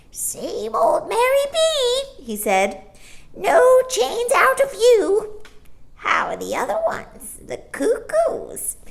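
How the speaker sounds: high, slow cocky bird voice; chirpy starling. A narrator's reading. Starling bantering with Mary Poppins, high and cocky.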